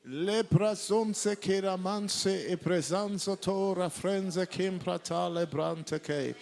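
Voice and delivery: loud, rhythmic tongues prayer over music; praying in tongues. Real, not acted. Preacher leads the church praying in tongues. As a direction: pour it out in fervent tongues.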